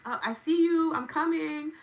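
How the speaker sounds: high, loud playful voice for money itself; money talking. Acted. Host voicing money as it finds the viewer, playful high voice. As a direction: voice money as an eager friend spotting you. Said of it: "Money's like".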